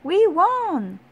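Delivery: high, loud victory shout. A narrator's reading. Boys telling the runner of the win, jubilant high shout. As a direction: shout the good news after the runner. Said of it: "shouted the boys".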